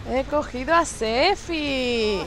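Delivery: slow, settled satisfaction; calmer gloat. Real, not acted. Killer savouring the caught friend, slow calm gloat. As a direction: savour the catch slowly.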